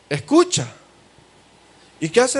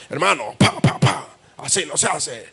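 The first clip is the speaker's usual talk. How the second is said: mock harsh rebuke, a bit altered voice; half-hearted stern telling-off; the wrong, harsh way a pastor corrects someone; harsh scolding; 3 semitones lower than usual. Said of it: "debe de ser con mansedumbre".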